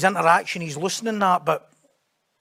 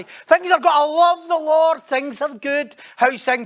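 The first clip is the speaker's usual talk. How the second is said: lightly voiced, higher, slow pious self-talk; mock contented believer; 7 semitones higher, 5 dB louder, slower than usual. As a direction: play the smug believer, slowly.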